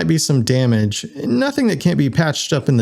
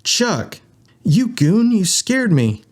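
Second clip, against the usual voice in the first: high-pitched mock outrage; hammed-up relieved scolding; playfully startled; 10 semitones higher, slower than usual. A narrator's reading. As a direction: yelp it at an old friend, pitched up. Winston greets friend who startled him.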